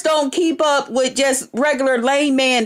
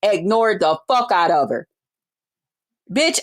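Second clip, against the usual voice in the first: usual speaking voice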